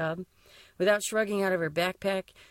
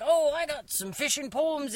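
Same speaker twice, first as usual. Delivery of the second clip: higher, slow mock old fisherman; old timer quote; 7 semitones higher than usual. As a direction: lightly ham up the old timer's boast. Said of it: "He would just be like".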